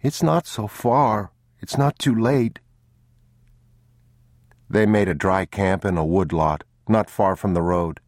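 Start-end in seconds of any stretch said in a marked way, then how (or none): 0.0-2.6 put-on high, unhurried child plea; soft-spoken boy. A narrator's reading. Boy urging father back for the child, soft high plea. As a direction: coax gently in a child's pitch. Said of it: "the boy said softly".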